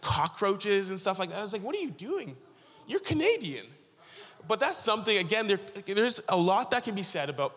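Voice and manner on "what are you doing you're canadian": high-pitched mock disbelief; retold incredulity. Preacher challenging kids eating cockroaches, incredulous. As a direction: call them out, baffled and much higher.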